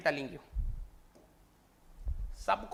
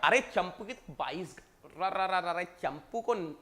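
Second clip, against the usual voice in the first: ordinary delivery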